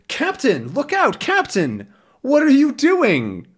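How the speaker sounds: high urgent cries; alarmed warning. Acted. Tintin warns Haddock urgently.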